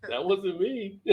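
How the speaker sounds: a hint of the dog protesting innocence; lightly voiced dog. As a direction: play the wrongly blamed dog, lightly. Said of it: "Dog like".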